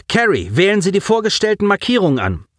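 high, quick order; urgent command. Acted. Commander to tactics officer, urgent order. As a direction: rap out the order to your crew.

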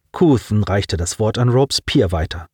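ordinary talk

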